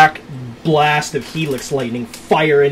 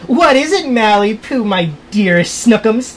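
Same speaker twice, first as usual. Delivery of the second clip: doting bride; 7 semitones higher, 5 dB louder than usual. Acted. Villain bride cooing pet names.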